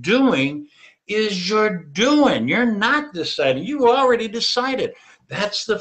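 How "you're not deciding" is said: usual speaking voice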